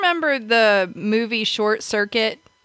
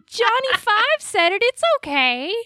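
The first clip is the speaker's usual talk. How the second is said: put-on much higher pleading kid voice; whiny kid; 12 semitones higher than usual. Still voicing her child self, high pleading.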